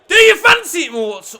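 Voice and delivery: high, loud sudden shout; loud urging. Real, not acted. Gamer suddenly yells at his player to shoot, loud urging. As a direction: bark it as the shot comes.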